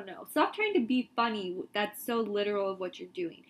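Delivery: ordinary talk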